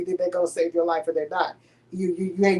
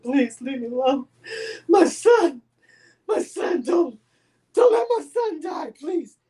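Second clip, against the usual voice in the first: much higher, crying pleading to the doctor; retold crying plea; 6 semitones higher, 3 dB louder than usual. Mother on the hospital floor begs the doctor, crying. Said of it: "That's how I was".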